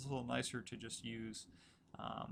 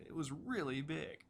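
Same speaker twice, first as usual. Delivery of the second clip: REALLY lifted high above the other words; emphatic, high-pitched "really"; stress on REALLY; 3 semitones higher, slower than usual. Shown as a demonstration. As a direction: punch up REALLY with a rise in pitch. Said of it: "emphasize the word really".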